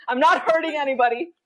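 normal talking voice

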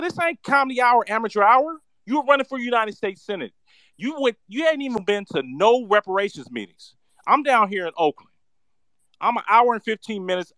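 high-pitched, loud, furious tirade; furious rant. Real, not acted. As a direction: tear into him, voice up high and loud. Ranting at unprepared candidate.

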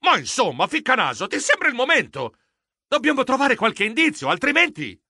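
lightly shrieked exasperation; mild annoyed yell. Acted. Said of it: "Io strillai".

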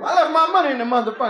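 frantic brother fussing outside the fire; panicked complaint. Acted. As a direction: blurt it out in a rush, all worked up. Panicked man outside burning club.

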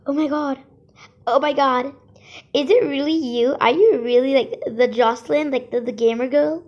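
loud starstruck fan gushing; excited fan. Acted. Fan meets famous gamer.

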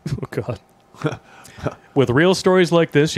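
ordinary talk

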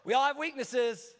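high-pitched, dismissive brush-off; acted self-excusing "oh, you know"; breezy excuse. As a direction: shrug off the blame lightly. Acted excuse to an upset friend.

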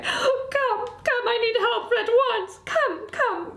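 high, tearful mouse voice; frantic distress. Acted. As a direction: urge them in with a high wail. Distressed mouse calls the officer inside.

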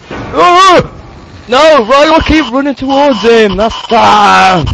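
high loud panicked shout; scared horror game streamer. Real, not acted. Fleeing monster in panic.

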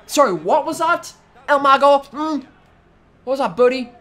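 high, loud mocking questions; taunting challenge. Player taunting El Mago after a knee, loud mockery. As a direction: demand it loudly, pitched well up.

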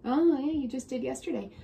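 plain reading voice

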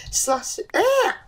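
high startled "ah" after a stumble; frustrated yelp. Real, not acted. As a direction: break off with a sharp high yelp. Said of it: "that's a tongue twister".

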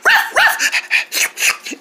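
high, breathy little puppy yap; puppy barking. Acted. The pet puppy Tongues greeting the viewers with a yap. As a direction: yap like a tiny excited puppy. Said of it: "this is our pet puppy dog".

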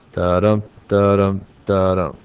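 "dadum" as an even beat, landing on dum; iambic beat. Shown as a demonstration. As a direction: chant each "dadum" with a weak da and strong dum. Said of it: "That would be three dadums".

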